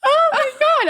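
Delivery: very high panicked cry, acted out; freaking out, retold. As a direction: replay alarm at the top of the range. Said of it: "I'm freaking out".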